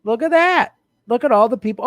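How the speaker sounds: mock excitement. Mock-excited over donor list.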